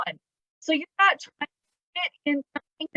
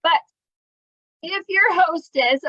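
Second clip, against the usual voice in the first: usual speaking voice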